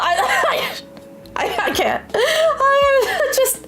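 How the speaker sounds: high squeaky laughing fit; can't stop giggling; helpless laughter. Real, not acted. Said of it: "don't mind me".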